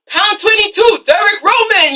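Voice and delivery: high, projected announcer call. Acted. Announcer calling Derek onto the field, projected call. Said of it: "the announcer's calling his name".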